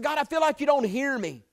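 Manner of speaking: high, loud, anguished cry to God; retold desperate prayer on the beach, crying out loud; angry plea to God. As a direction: pour out your anger at God. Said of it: "And I was angry".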